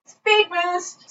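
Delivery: high, game-like exclamation; playful call-out. Retold or imitated. Skateboarder launching down the hill, playful call-out.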